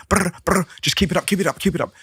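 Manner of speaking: high, rapid rolled trills and chatter; mock nonstop performer babble; imitating frantic patter. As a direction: rattle it off high, like filling every silence. Imitates own early nonstop stage patter.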